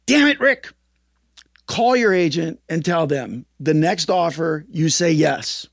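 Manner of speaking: mock bark of a fed-up mentor; exasperated scolding. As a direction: sponsor snapping at Rick over the phone. Sponsor scolding him on phone.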